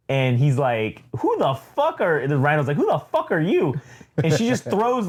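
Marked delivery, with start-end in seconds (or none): 1.2-2.2 high-pitched incredulous demand; mock outraged challenge, pitched way up; acted indignation. Acting out challenge to Typhoid Mary. 2.8-3.7 high, incredulous mock challenge; exaggerated baffled demand; acted indignation. Rhino challenges Typhoid Mary, acted.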